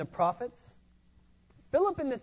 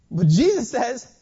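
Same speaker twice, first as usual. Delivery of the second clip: normal talking voice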